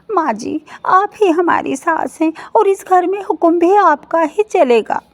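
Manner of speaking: daughters-in-law giving in to their mother-in-law; placating daughter-in-law. Acted. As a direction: soothe the angry mother-in-law.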